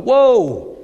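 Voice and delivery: big, high, loud whoa; sudden swooping cry of amazement; startled whoa. Acted. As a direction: exclaim it in mock astonishment. Mimics a startled reaction.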